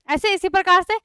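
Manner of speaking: ordinary delivery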